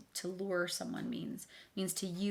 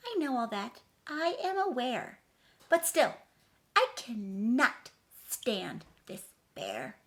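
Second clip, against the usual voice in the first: high, loud, sing-song rhyming read; dramatic storybook reading; 7 semitones higher, 7 dB louder, slower than usual. A narrator's reading. Reading the picture book to children, dramatically. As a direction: read the rhyme big for kids.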